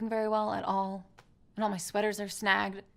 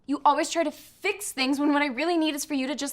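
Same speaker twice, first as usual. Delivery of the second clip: raised, exasperated voice, louder and higher; exasperated complaint; 6 semitones higher, 6 dB louder than usual. Acted. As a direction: snap at him for not listening. Sketch, couple argument.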